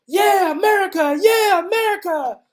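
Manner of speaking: high-pitched, loud imitation of cheering women; excited cheering. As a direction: whoop it out like an excited crowd of fans. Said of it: "They were just so excited".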